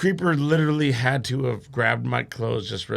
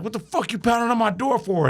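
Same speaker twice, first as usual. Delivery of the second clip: higher-pitched, indignant mock yell; angry retold demand; 6 semitones higher than usual. [name] to the dude from the Escalade, angry retold demand. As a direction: challenge him, imitating an irate neighbor.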